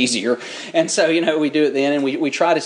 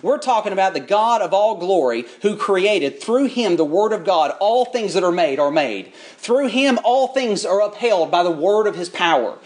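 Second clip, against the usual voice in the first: higher, rising preacher's cadence; building sermon; 6 semitones higher than usual. Acted. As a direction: lift the congregation with each phrase. Preaching at full force.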